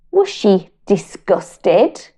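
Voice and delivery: usual speaking voice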